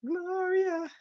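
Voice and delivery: high singsong name. Pretend. Says her persona name.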